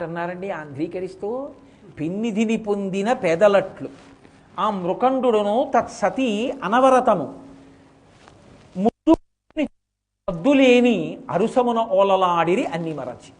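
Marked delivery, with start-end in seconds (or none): 2.0-13.3 slow, measured recital of verse; poem recitation. Acted. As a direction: intone the verse slowly. (నెమ్మదిగా పద్య పఠనం)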